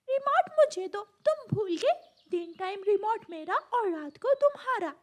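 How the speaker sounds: put-on high, insistent child-bird voice; squabbling siblings. Siblings fighting over the TV remote, squabbling. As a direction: demand your turn crossly.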